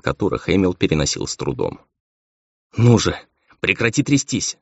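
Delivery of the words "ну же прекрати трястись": urgent, higher-pitched self-command; tense inner command, raised pitch; ordering himself. A narrator's reading. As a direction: order yourself to pull it together. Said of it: "приказывал себе полудемон".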